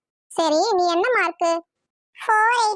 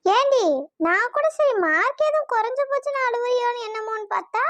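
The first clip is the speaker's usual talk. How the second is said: ordinary delivery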